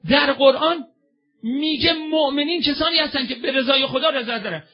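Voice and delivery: loud, high, crying-out sermon voice; fervent sermon lament. Acted. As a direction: call out to the crowd with rising fervour. Preacher's lament and exhortation.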